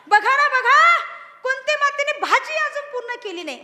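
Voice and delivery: high, loud anxious urging; agitated plea. Acted. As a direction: point out the unfinished cooking urgently. Frightened Arjuna points to Kunti. (घाबरलेला Arjun कृष्णाला उत्तेजितपणे दाखवतो)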